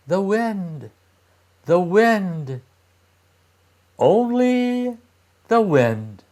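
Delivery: sing-song innocent reply, not a big change; children fibbing to the voice, sing-song; children's answer. A narrator's reading. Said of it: "answered the children".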